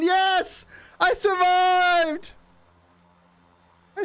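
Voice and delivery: triumphant cheer. Real, not acted. Streamer cheering as his plane pulls out of the dive. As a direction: yell it like you cheated death. Recovers from the plunge.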